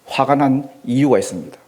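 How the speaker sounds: usual speaking voice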